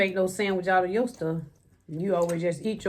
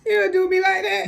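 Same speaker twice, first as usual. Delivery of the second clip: laughing; 13 semitones higher, 6 dB louder than usual. Real, not acted. Laughing protest.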